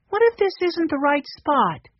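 put-on high, light little boy voice; young Benny. A narrator's reading. Narrator voicing young Benny.

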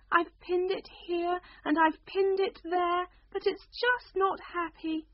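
a flustered queen fussing over her shawl. Acted.

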